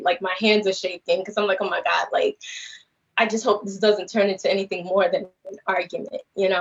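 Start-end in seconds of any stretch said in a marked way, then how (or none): none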